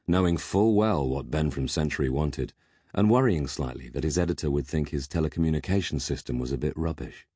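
usual speaking voice

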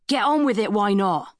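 high-pitched heckle from a sailor; anonymous shout. A narrator's reading. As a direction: call it out from among the crowd. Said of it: "The anonymous shout had come".

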